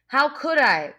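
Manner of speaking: put-on mild indignant outcry from a boy; indignant cry. A narrator's reading. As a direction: protest like he's been wronged. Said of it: "cried Piggy indignantly".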